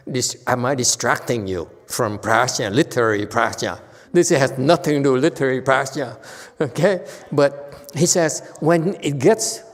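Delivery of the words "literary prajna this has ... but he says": ordinary talk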